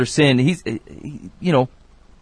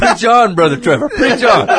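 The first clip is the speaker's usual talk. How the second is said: high, laughing call of encouragement; cheering him on; 7 semitones higher, 4 dB louder than usual. Real, not acted. To Brother Trevor, a laughing high call to preach on.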